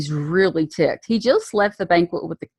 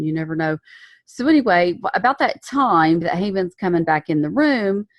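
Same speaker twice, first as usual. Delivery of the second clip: ordinary talk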